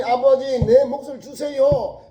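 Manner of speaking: a lightly voiced whining son asking for his share; son's plea. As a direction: beg the father like a spoiled son. Son demanding his inheritance.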